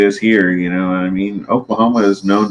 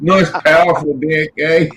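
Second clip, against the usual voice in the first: lightly put-on New Zealand accent, higher; mock Kiwi accent; 5 semitones higher than usual. Ribbing a Kiwi friend, in a higher mock Kiwi accent.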